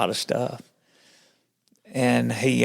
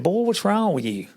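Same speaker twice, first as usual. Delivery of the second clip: higher-pitched quoted greeting; imitating him with a high lilt; pastor's greeting; 10 semitones higher than usual. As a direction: call it out high, then drop.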